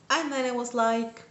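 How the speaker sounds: ordinary talk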